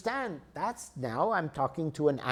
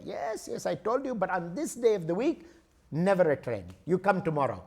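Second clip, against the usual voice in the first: Indian ticket clerk; 2 semitones higher than usual. Acted. Indian clerk explains missing train to traveler, Indian accent. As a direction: placate the customer in an Indian English lilt. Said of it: "It's the same guy".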